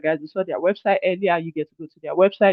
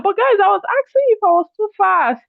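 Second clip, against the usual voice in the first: indignant and surprised, much higher; high, surprised protest; 5 dB louder than usual. Real, not acted. Protesting the chat's complaint.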